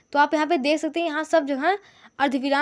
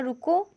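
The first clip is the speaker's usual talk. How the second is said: रुको held up, then a clear stop; रुको set apart and left hanging; pause after रुको; 2 semitones higher, slower than usual. Shown as a demonstration. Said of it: "फिर comma लगा है".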